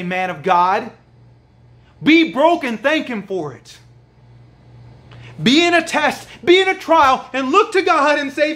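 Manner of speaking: high, loud fervent preaching; preaching at full force. Acted. Preacher urging the congregation to give thanks, fervent. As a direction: lift the congregation to thank God.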